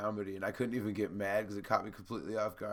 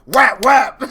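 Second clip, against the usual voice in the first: two loud, high smack words; acted-out punches; 11 semitones higher, 21 dB louder than usual. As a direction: shout each like a blow landing, amused. Acting out punches after the joke.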